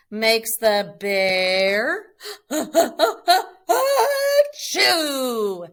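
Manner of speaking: big hammed-up sneeze; loud comic sneeze. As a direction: burst out into a loud silly sneeze. Bear sneezes in story.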